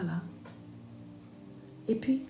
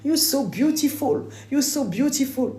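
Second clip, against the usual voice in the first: much higher, slow, gushing mock American voice; admiring American women; 10 semitones higher, 4 dB louder than usual. The American women gush over her looks, mock American gushing. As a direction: gush like a starstruck fan. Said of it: "la sirène qu'ils aimaient".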